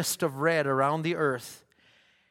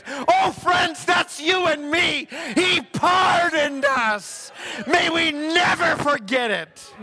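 much higher, louder, slow shouted sermon; preaching at full force; 12 semitones higher, 5 dB louder, slower than usual. Real, not acted. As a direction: thunder it out to the congregation.

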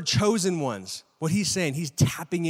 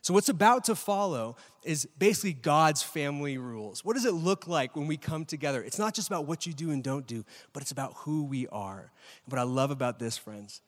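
ordinary talk, nothing marked

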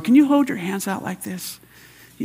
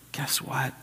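normal talking voice

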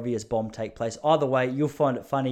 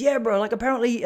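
put-on Kiwi accent, pitched up; New Zealand accent; 10 semitones higher, 4 dB louder than usual. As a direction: imitate a Kiwi bro, higher-pitched. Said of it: "This is my New Zealand accent".

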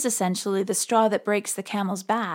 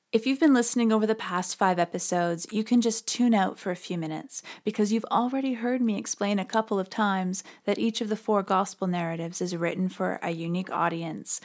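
ordinary talk

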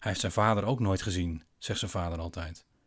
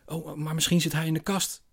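put-on higher, eager little boy; a small boy with a sudden idea; lightly voiced young child; 9 semitones higher than usual. Child Tommy speaks to father.

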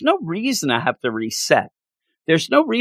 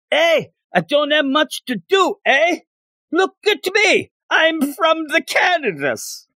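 put-on Canadian accent. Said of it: "That's how I think everybody in Canada talks".

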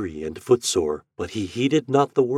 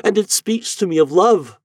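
higher, louder voice in rising wonder; Eloise cries out; 10 semitones higher, 6 dB louder than usual. A narrator's reading. Eloise cries out to the master, high and louder. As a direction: cry out as if hearing her dead husband. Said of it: "cried Eloise".

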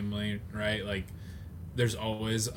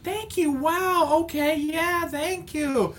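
much higher, slow overpolite thanks; a hammed-up high polite thank-you; forced politeness; slower than usual. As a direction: gush thanks while hiding the doubt. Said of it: "And they're like".

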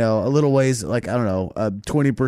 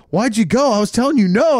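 higher, exasperated scolding; high exasperated brother; 10 semitones higher, 4 dB louder than usual. Retold or imitated. Brother scolding after the fall, high exasperated. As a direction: pitch it up in mock disbelief. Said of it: "He goes".

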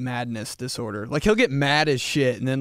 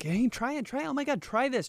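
pushy date; 12 semitones higher than usual. Retold or imitated. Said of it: "they're like".

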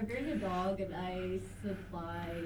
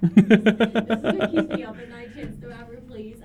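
loud plea; 2 semitones higher, 16 dB louder than usual. Real, not acted.

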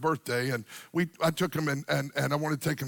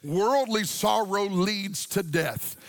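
fervent preaching; 6 semitones higher than usual. Acted. Pastor exhorting the congregation, fervent sung-out preaching. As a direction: preach it, swelling each line.

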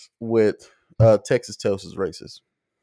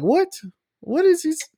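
high baffled yelp, cut off mid word; mock confused. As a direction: play dumb in disbelief at the insult. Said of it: "You're like".

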